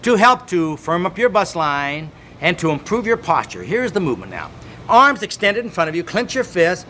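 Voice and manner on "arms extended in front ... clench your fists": pumped-up fitness coach calling the count over music; exercise count-along. Acted. Coach counting the hand exercise for viewers at home, upbeat and driving. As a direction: drive the class through the reps, energetic.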